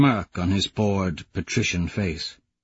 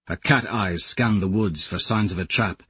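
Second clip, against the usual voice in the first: ordinary talk, nothing marked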